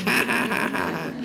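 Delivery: high, honking duck laughter; mocking duck laugh. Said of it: "They're like".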